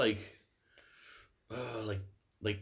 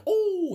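mock yelp; 7 dB louder than usual. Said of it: "he's like".

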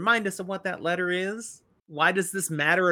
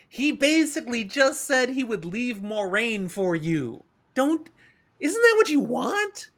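much higher, rising disbelief; incredulous friends; 8 semitones higher, 4 dB louder than usual. Retold or imitated. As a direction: press her for an explanation. Friends questioning Nynaeve.

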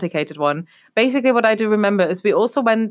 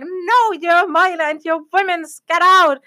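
high-pitched acted-out refusal, loud at the end; high mock yell building to a louder finish; retold shouting; 10 semitones higher, 4 dB louder than usual. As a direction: shout down a protest, re-enacted. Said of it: "started shouting".

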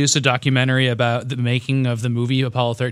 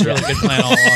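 sarcastic; 4 dB louder, faster than usual. Acted. Sarcastic praise, laughing.

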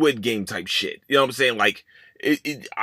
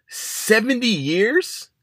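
slow, drawn-out disbelief, pitched higher; incredulous; 6 semitones higher, much slower than usual. Real, not acted. Host hearing about the seventy-year sentence, slow incredulous disbelief. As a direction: stretch it out like you can't believe the number.

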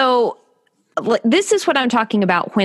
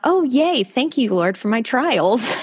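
high, chirpy mock cheer; mock cheerful; 6 semitones higher than usual. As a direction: sing it out with fake delight. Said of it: "my reaction is not".

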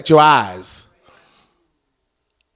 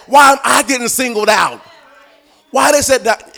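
indignant shout; 8 semitones higher, 6 dB louder than usual. Retold or imitated. Singled-out believer complaining.

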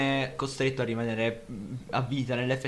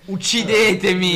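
loud, slow melodramatic cry; dramatic shout; 7 semitones higher, 10 dB louder, slower than usual. Acted. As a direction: wail it like a tragic hero. Mock despair after flubbing English.

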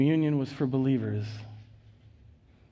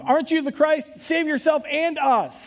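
high, loud scornful criminal; shouted insult, far higher and louder; hurling insults; 13 semitones higher, 5 dB louder than usual. A narrator's reading. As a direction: fling the words at him in contempt. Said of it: "hurled insults at him".

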